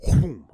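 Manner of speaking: quick, loud imitated whoom; one sudden burst of mouth noise; sudden whoosh sound. Dad mimics daughter dropping off to sleep, sudden whoosh. Imitates her going under instantly.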